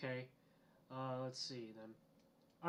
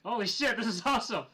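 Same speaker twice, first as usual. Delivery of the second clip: loud, high-pitched delight; excited outburst, pitch jumping up; real excitement; 8 semitones higher, 11 dB louder than usual. Real, not acted. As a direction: burst out thrilled at a big prize. Player thrilled at getting Gatling gun.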